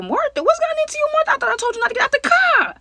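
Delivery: very high, loud disbelieving cry; shocked squeal. Retold or imitated. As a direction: shriek it in disbelief.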